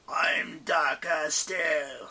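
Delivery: slow whispered snarl. Acted. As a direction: breathe it out slowly. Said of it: "snarled Garrus".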